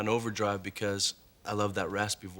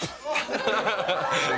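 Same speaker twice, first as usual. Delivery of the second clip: bursting out laughing; big hearty laugh, high and loud; 10 semitones higher, 7 dB louder than usual. Real, not acted. Mark laughs about his answer.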